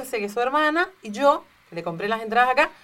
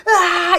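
mock fan scream; 6 semitones higher, 5 dB louder than usual. Mother screams at concert.

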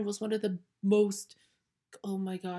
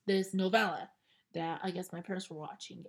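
ordinary delivery

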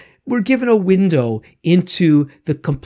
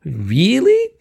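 REALLY rising high as a question; acted REALLY with a steep upward swoop; questioning reply; 6 semitones higher than usual. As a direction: answer with a drawn-out, climbing REALLY. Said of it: "translating is translating as".

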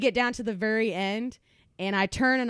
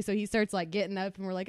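ordinary talk

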